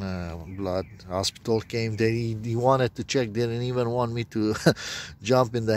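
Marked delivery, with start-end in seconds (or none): none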